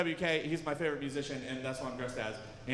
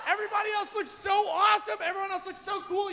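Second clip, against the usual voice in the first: mock outrage; 10 semitones higher than usual. Real, not acted. Comic complains to the crowd about the photos, high whiny outrage. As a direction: protest like it's deeply unfair.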